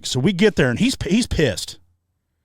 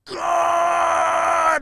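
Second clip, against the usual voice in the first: high held scream of pain; pained yell; 13 semitones higher than usual. Retold or imitated. Injured friend screaming as pad is shoved in. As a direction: bellow it out as the pad goes in.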